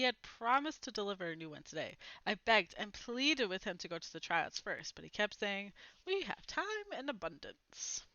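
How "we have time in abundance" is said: higher, softer breezy dad voice; unhurried dad brushing off the worry; easygoing dad. A narrator's reading. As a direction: toss it off like a carefree father. Dad brushing off pleading kids.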